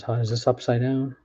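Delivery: usual speaking voice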